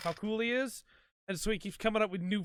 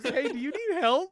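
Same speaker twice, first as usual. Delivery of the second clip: sweet concerned woman; 8 semitones higher, 6 dB louder than usual. Retold or imitated. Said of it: "she stops and goes".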